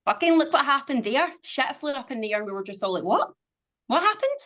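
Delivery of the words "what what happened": stunned cry pitched way up, rising; mock bewildered. All of us reacting to the pandemic, mock bewildered. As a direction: imitate baffled disbelief, voice shooting up high. Acting out everyone's shock at the pandemic.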